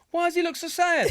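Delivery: high, innocent questioning voice; mimicking his wife's sweet question; imitating missus. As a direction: ask it like a puzzled newcomer to football. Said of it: "And then she's like".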